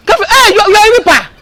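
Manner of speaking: loud taunt. Acted. Taunting the rival.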